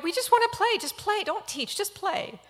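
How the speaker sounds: put-on high, loud whiny children's voice; impatient kids. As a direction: beg like eager little kids. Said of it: "they're like".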